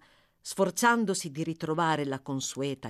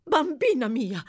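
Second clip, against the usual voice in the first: higher, louder cry of alarm; exclaiming aunt; 8 semitones higher, 6 dB louder than usual. A narrator's reading. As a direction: call out to the girl in alarm. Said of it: "esclamò la signora abbracciandola". (esclamazione allarmata, più acuta e forte)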